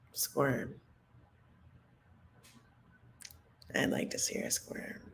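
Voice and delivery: hushed, savoring the thought; soft gleeful whisper; gloating whisper. Acted. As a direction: whisper it with relish. Relishing wish to see her squirm.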